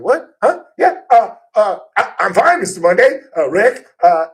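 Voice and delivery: high, loud, tripping over his own words; flustered starstruck stammer. Retold or imitated. Radio host retelling meeting his idol, flustered high stammer. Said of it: "was like".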